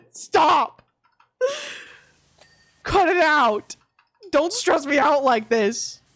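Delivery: loud, high mock scolding; exasperated outburst. Scolding vet for scaring her.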